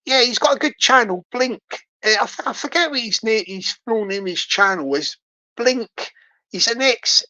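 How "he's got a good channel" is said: usual speaking voice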